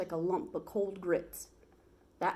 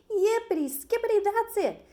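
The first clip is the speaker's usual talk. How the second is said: much higher, louder gleeful cowboy cheer; joyful whoop; 14 semitones higher, 7 dB louder than usual. Acted. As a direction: bounce the words up like jumping. Sam jumps for joy.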